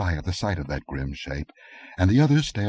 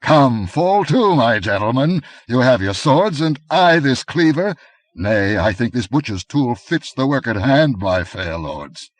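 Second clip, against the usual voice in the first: mocking taunt; 6 semitones higher, 7 dB louder than usual. A narrator's reading. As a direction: relish inviting them to fight. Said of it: "mocked Conan".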